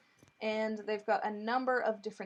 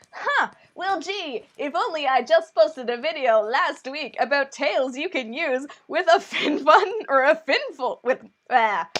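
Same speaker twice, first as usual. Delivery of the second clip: sarcastic; 4 semitones higher, 7 dB louder than usual. Acted. Sarcastic reply to viewer question.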